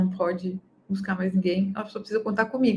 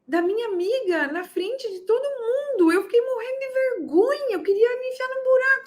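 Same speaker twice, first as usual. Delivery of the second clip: much higher, mock-horrified mom; mortified mother; 10 semitones higher than usual. Mother complains about her daughter hitting a friend, much higher and mock-horrified. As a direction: gasp out your shame like a self-centred mother. Embarrassed self-centred mother voiced.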